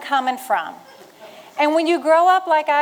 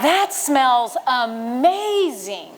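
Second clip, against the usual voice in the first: savoring; much slower than usual. Retold or imitated. Savoring the farm smell.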